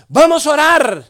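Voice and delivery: grito agudo llamando a orar; exclamación exaltada imitada. Retold or imitated. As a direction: imitar una arenga fuerte y aguda. (exclamación exaltada, imitada)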